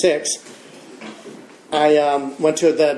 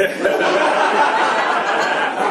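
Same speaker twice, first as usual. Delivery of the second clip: breathy laugh at the memory; amused chuckle; 4 semitones higher than usual. Real, not acted. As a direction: let a laugh out. Laughing at own story.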